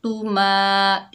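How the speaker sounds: slow, drawn-out Quranic recitation. Shown as a demonstration. Teacher reciting the word for pupils, slow chanted recitation. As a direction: recite it slowly, like tilawat. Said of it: "एक अलिफ की मिकदार खींच कर पढ़ेंगे".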